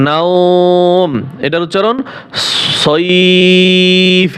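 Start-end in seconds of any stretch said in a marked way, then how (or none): 0.1-1.1 নাওম with the আও held long; stretched vowel. Shown as a demonstration. Teacher giving the stretched reading of নাওম. 2.8-4.3 সইফ with a long ই glide, not a short সব; stretched vowel. Shown as a demonstration. Teacher warning students not to clip সইফ. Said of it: "যেন সব যেন না বলেন".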